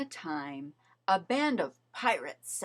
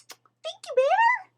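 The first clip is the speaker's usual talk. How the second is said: high, relieved little rabbit; grateful rabbit, high; 10 dB louder than usual. A narrator's reading. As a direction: thank him in a high rabbit voice. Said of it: "said Rabbit".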